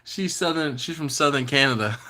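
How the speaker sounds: high, laughing through the words; can't stop laughing at the joke. Real, not acted. Cracking up at a viewer's joke about Southern Canada. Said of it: "Grumpus is so funny".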